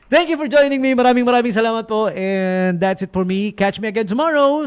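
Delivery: high, upbeat announcer outro; a DJ closes the show, bright announcer voice; radio DJ sign-off. Acted. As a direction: sell the sign-off like a radio host.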